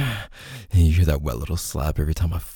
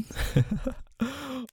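soft laugh; 7 dB quieter than usual. Real, not acted. Laughs at partner's request.

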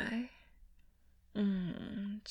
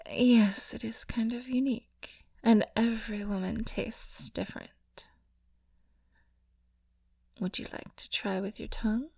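breathy intimate whisper, close to the mic; sensual ASMR whisper; 7 dB louder than usual. Acted.

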